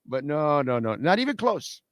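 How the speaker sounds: ordinary delivery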